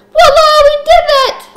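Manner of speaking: very high, loud celebratory squeal; triumphant cheer. Pretend. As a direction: shriek it out in hammed-up triumph. Celebrates getting the dough done.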